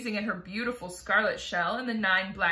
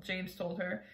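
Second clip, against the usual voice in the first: ordinary delivery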